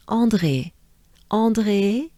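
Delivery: "André" in a French accent, nasal first vowel and French R; French-accented teacher's model of "André". Shown as a demonstration. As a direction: say "André" the French way, ending on a pure é. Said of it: "But with a French accent".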